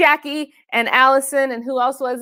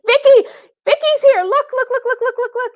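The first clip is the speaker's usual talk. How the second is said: high, loud excited squeal; 14 semitones higher, 4 dB louder than usual. Real, not acted. Host thrilled to announce the winner, high excited squeal. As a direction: burst out with delight at the winner.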